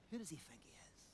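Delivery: whispered sneer at the newcomer; mock scornful whisper. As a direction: hiss it like a snobbish Athenian. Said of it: "as if they're saying".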